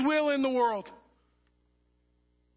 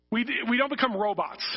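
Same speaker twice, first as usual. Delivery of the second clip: ordinary talk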